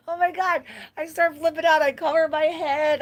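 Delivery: high, loud, keyed-up telling; excited retelling. Retold or imitated. Telling viewers about the bat, keyed-up. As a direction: relive the fright breathlessly.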